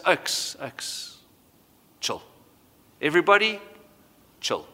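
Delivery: sleepy, hushed half-whispered calm-down; a just-woken man telling everyone to relax; groggy Jesus. Retold or imitated. Jesus, freshly woken, hushes the panicked disciples sleepily. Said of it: "he wakes up and he goes".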